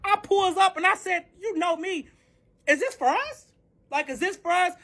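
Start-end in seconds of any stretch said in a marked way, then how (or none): 1.4-4.7 high-pitched, indignant retold challenge. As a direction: throw the questions out high and affronted. Confronting someone in retold quarrel.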